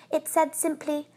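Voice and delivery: ordinary delivery, nothing marked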